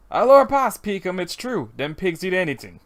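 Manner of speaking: put-on Southern accent with dropped-in Louisiana French; folksy attempt at a Southern accent; deep Southern accent. Assuring a partner that pigs eat anything, Southern accent. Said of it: "really deep southern accents ... random use of French".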